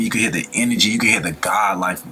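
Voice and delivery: usual speaking voice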